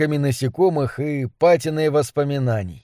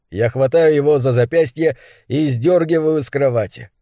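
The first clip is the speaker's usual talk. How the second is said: plain reading voice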